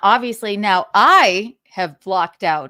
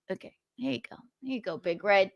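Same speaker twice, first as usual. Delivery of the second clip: ordinary talk, nothing marked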